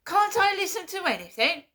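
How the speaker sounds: high, loud indignant outburst; retold shouting. Imitating mum shouting down the phone in indignation. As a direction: snap back as an offended mum. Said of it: "Started shouting down the phone".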